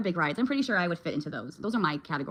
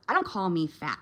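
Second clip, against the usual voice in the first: ordinary talk, nothing marked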